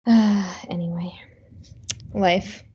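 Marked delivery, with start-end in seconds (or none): 0.1-1.1 breathy tired aside; weary whisper after the story; whispered sigh. Real, not acted.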